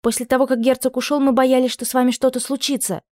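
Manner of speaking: put-on high, anxious girlish voice; frightened young maid. A narrator's reading. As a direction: blurt it out, scared.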